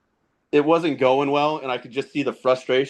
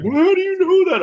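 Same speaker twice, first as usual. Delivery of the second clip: put-on much higher, louder kid voice; surprised kid; 6 dB louder than usual. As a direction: squeak it out like a stunned boy. Son asks dad, surprised.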